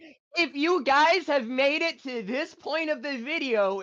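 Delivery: high, loud YouTuber intro voice. Pretend. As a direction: pitch it up like a hyped YouTuber. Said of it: "YouTuber voice".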